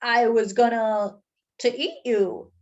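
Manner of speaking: slow, stunned admission in another voice; shocked. Acted. Mister Bing answering the donut, slow and shocked. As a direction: say it dazed, caught off guard. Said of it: "he replied in shock".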